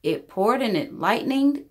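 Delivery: usual speaking voice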